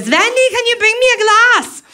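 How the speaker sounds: put-on high old lady request; grandma. Old grandma calling downstairs asking Wendy for a glass, high and old. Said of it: "She goes".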